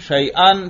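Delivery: شیئن pronounced "shay-an"; fatha ending. Shown as a demonstration. Teacher showing hamza takes every case, "-an" ending. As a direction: end شیئن on a clear "-an". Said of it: "حمزہ کے اوپر تینوں اعراب".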